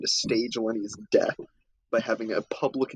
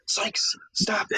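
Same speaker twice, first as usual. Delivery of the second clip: Oscar hissing in a whisper; hushed, retold warning; urgent hushed Oscar. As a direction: whisper it urgently to shut a friend up. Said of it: "And Oscar's like".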